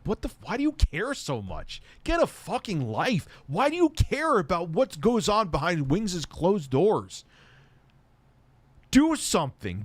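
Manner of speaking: high-pitched, incensed outburst; exasperated rant. Real, not acted. Streamer scolding trolls for meddling in a marriage, exasperated rant. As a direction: berate them in real exasperation, drawing out the insults.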